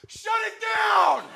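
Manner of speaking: high, slow, drawn-out yell; shouted order. Retold or imitated. Ride kid stopping the whole coaster, drawn-out yell. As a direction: bellow it like an alarm. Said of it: "the guy just goes".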